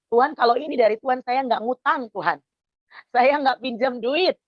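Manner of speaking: high, pleading prayer voice; fervent prayer. Retold or imitated. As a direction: cry out to God in earnest faith. Retold prayer while waiting for money.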